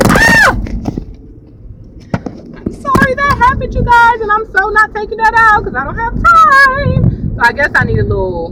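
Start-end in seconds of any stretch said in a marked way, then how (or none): 0.1-0.6 1 startled yelp. Real, not acted. Startled yelp while driving. 2.6-7.3 1 little high voice. Real, not acted. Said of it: "stop talking in my little high voice".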